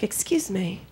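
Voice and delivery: soft, low-key inquiry; mock polite customer. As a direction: ask softly, setting up the outburst. Acts polite customer before the outburst.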